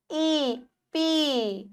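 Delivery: ई and पी held long and slow; long drawn vowel. Shown as a demonstration. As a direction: draw out the ee in ई, पी. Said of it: "हल्का सा हम खींचते हैं इसको". (ई और पी को खींचकर, धीरे बोलें)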